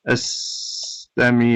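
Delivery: "astami'u" spelled out slowly, syllable by syllable; "astami'u" drawn out, each syllable distinct; slow careful pronunciation. Shown as a demonstration. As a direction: say "astami'u" slowly and clearly for students. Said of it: "but originally without any of that".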